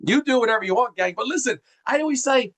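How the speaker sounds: usual speaking voice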